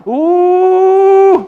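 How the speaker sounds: high, long "uuuh" whoosh; sustained high wind noise; imitated howling wind.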